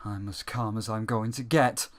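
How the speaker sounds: low, flat, bored hero voice; deadpan low monotone mockery; monotone character impression. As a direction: drone it like an unbothered game character. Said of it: "same monotone voice".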